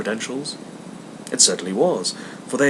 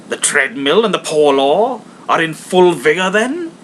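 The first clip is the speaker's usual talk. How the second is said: put-on rising, sarcastic old miser; mildly sneering Scrooge; mocking Scrooge; 7 semitones higher, 8 dB louder than usual. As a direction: needle them with the question.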